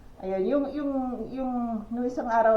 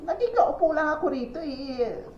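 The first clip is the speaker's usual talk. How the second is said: higher-pitched mock protest of a homeless man, lightly voiced; angry homeless man; 8 semitones higher than usual.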